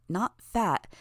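ordinary delivery, nothing marked

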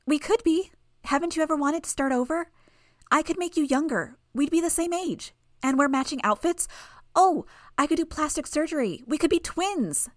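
put-on a creepy child selling her dream; Bonesaw's girl voice.

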